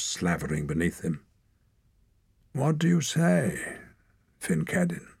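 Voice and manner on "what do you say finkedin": put-on old mage. A narrator's reading. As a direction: weigh each word. Old mage challenges leader.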